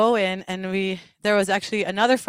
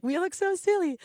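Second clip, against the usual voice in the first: higher, playful self-mockery; joking; 8 semitones higher, 3 dB quieter than usual. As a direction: tease yourselves lightly. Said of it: "joking, like".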